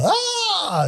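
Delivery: high yelp of a scream, short and sharp; a sudden mock scream; retold scream. Husband waking up screams at the gizzards, mock high yelp. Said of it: "he hears him just scream out loud".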